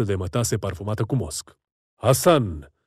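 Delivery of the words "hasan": calling out. A narrator's reading. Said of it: "strigă unui ofițer".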